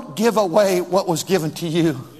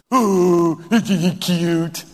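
slow put-on cooing; mock baby talk; much slower than usual. Mother fussing over a boy with his blankie, mock cooing. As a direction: imitate fawning over a little one. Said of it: "Why are you talking like that".

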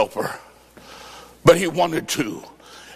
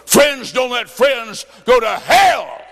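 preaching shout; 6 semitones higher, slower than usual. Acted. Preacher slowly shouting a warning at the congregation. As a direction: hammer each word out at the congregation.

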